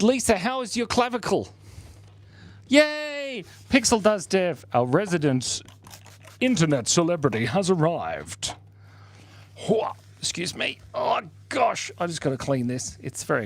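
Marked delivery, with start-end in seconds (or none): none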